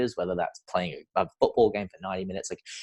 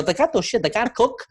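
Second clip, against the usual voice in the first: much higher Zimbabwean accent imitation; funny accent; 3 dB louder than usual. Swim coach mocking swimmers who can't cook, in a high Zimbabwean accent. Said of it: "he used to have this funny accent".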